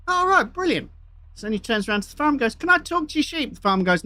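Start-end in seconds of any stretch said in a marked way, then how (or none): 0.1-0.9 lightly voiced, much higher visitor; a hint of a high-voiced joke character. Visitor replies in told joke. 2.5-3.5 lightly voiced, much higher visitor; a hint of a high-voiced joke character. Visitor asks in told joke.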